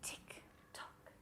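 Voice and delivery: whispered, evenly spaced clock ticks; tiny, measured whispered beats; ticking clock. Acted. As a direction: whisper each tick like a clock.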